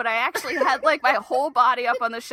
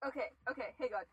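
hushed panic; 4 semitones higher, 8 dB quieter, faster than usual. Real, not acted. Hiding from spider.

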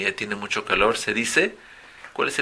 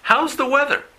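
high, loud teacher model; modelling for students to repeat; model phrase for repeating; 14 semitones higher, 6 dB louder than usual. Shown as a demonstration. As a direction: call it out bright and high for copying. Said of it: "La otra forma que es muy común".

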